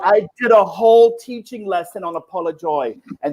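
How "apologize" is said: "apolojoy" with no final z; softer "apolojoy", last consonant of apologize missing; dropped ending sound. Retold or imitated. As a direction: close "apolojoy" on the oy vowel. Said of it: "he didn't say the ending sound".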